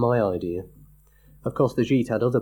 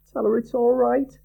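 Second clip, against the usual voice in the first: high, louder urging; anxious friend; 4 dB louder than usual. A narrator's reading. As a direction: push the speaker to reassure. Another friend urges.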